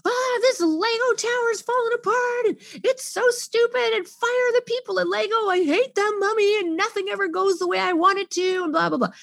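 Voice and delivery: put-on high whiny kid's voice; whining upset child. Child whose Lego tower fell apart, high whiny rant. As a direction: play a frustrated little kid ranting.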